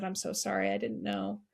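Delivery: tearful and hushed, saying sorry; comforting a widowed coworker, quiet and teary; soft tearful apology. Real, not acted. Both in tears, comforting him.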